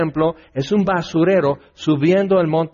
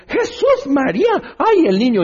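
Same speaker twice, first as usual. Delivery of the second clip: high squealed cry of an anxious mom; fretting mother; 12 semitones higher, 3 dB louder than usual. Retold or imitated. A mother fussing over her sneezing child, high alarmed squeal.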